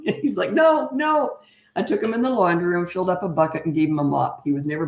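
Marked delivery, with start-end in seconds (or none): 0.5-1.4 son protesting. Retold or imitated. Son backs off in panic.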